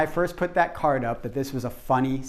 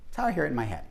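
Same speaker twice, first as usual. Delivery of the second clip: normal talking voice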